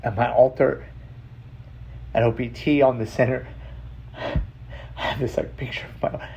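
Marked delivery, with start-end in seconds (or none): 4.2-6.3 choked-up whisper; barely voicing through emotion. Real, not acted.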